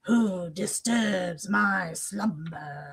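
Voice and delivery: slow, raspy booming cave spirit. Retold or imitated. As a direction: drag it out like an ancient cave. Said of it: "that was my first raspy".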